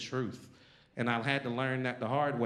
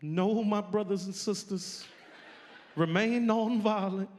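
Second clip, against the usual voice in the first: higher, slow, preacherly calm; 7 semitones higher than usual. Retold or imitated. Imitating King calming marchers, higher slow preacher voice. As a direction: lift it up like a sermon. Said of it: "And he's like".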